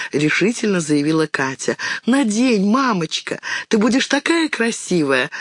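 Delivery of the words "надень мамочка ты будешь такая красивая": put-on pleading girls. A narrator's reading. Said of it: "запросили девочки".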